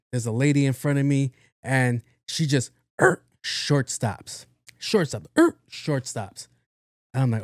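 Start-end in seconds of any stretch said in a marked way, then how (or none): 3.0-3.2 short, loud tire-screech noise; screeching brake imitation. Imitates car braking short. 5.4-5.6 sudden loud brake noise; screeching brake imitation. Imitates car braking short.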